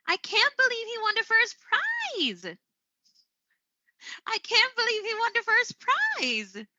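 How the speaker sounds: high-pitched amazed exclamation, held up until "prize"; demonstrating astonished excitement, voice soaring; excited disbelief. Shown as a demonstration. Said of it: "with the excitement, the disbelief, uh, from my voice".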